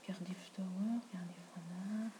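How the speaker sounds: whispered radio call, under breath; whispering. Real, not acted.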